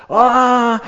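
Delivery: very high, loud cry of delight; joyful shout. Real, not acted. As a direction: burst out with joy at the door. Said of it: "Y gozo".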